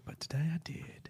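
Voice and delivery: breathy unvoiced whisper. Real, not acted. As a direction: whisper it like a secret. Playful whisper of pride.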